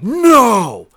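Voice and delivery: long, high disbelieving yell; imitated freak-out. Drunk friend learns who his old bandmate is, freaking out. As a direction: wail it in mock astonishment. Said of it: "he just starts freaking out".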